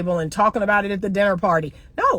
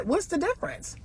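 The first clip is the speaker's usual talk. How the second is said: ordinary talk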